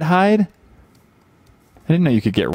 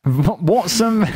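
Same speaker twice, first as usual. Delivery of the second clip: halting, higher-pitched try at Aussie; attempted Australian accent; 6 semitones higher, slower than usual. Shown as a demonstration. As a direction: have an unsure go at an Australian accent. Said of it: "I've not practiced an Aust-, Australian accent".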